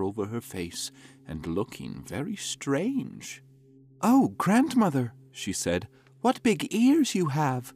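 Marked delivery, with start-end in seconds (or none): 4.0-7.7 put-on high, wondering child; curious little girl. A narrator's reading. Girl questions wolf.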